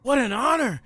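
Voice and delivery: high, excited, starstruck voice; gushing fan. Acted. Player gushing at date's mother.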